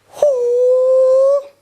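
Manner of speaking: high sharp hoo cry; a loud high karate yell; sharp high-pitched hoo. Shown as a demonstration. Karate teacher's crane jump cry.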